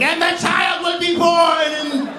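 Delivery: high, slow shrieking prophecy; witch prophecy. Acted. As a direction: screech it like a possessed witch.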